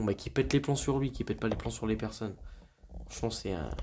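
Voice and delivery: ordinary talk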